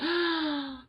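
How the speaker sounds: sharp high frightened gasp; high startled gasp. Retold or imitated. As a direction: gasp in mock horror at the word sick. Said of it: "and I'm like".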